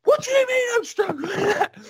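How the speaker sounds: mock-hysterical shriek, very high and loud; shrill, affronted retort at the top of the voice. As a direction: imitate her outraged screech. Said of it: "Started going all hysterical".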